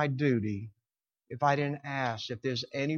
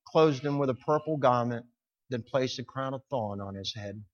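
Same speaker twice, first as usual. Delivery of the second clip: ordinary talk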